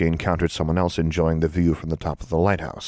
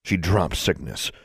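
ordinary delivery

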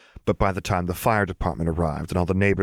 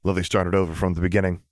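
plain reading voice

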